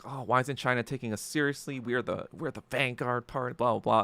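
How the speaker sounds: soft, fast mocking whine; sneering imitation of the parties, quick and soft; mocking whiny quote. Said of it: "they're like".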